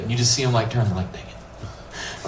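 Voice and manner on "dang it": whispered under-breath grumble; muttered grumble. Acted. Peter turning away, whispering a sulky complaint. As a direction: hiss it like a sulky fisherman.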